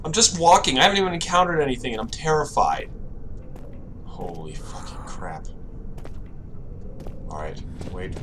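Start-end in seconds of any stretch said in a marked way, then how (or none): 4.1-8.2 scared whisper. Real, not acted. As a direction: whisper it, terrified. Creeping through dark game.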